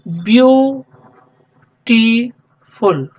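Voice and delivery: ब्यूटीफुल drawn out very slowly, each syllable held; teacher modelling ब्यूटीफुल slowly; slow spoken model word. Shown as a demonstration. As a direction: stretch out ब्यूटीफुल syllable by syllable for the class. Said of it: "आप इसको pronounce कीजिए".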